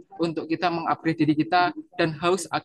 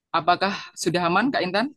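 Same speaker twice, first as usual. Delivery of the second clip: ordinary talk, nothing marked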